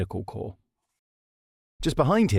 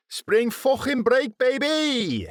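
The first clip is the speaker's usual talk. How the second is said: high, stretched party cheer; whooping; slower than usual. A narrator's reading. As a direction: whoop it like a frat boy. Greaves arrives whooping.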